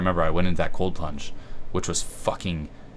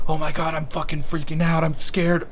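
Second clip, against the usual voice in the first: mock freaking out; 10 semitones higher, 4 dB louder than usual. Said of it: "Instead of".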